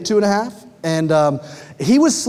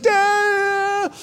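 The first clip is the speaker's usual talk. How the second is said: imitating toddler; 13 semitones higher than usual. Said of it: "And he's like".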